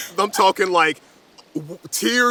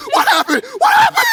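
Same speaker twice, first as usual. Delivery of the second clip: high, loud, laughing panic; frantic worried dad; panicked alarm; 5 dB louder, faster than usual. Acted. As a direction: rush in alarmed. Worried father rushes back.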